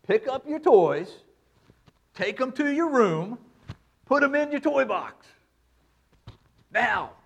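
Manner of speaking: put-on a firm father telling his child to tidy up; the no-nonsense tone of a dad giving an order; stern dad voice. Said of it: "That's the tone of a dad".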